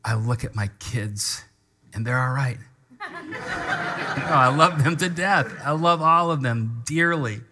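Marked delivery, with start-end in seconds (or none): 3.0-4.1 squeaky high amused laugh; short giggle. Real, not acted. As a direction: laugh at your own joke. Jokes about kids.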